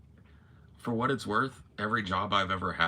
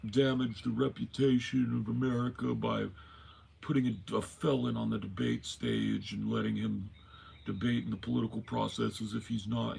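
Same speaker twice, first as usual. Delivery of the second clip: Biden impression; 6 semitones higher than usual. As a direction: stammer through it like Biden. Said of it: "Biden can be like".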